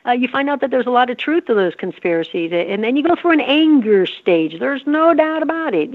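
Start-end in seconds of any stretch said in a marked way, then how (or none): none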